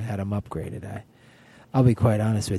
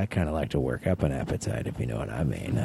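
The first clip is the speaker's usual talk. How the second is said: lower suggestive tone; 11 semitones lower, 4 dB quieter than usual. Retold or imitated. Innuendo to flight attendant.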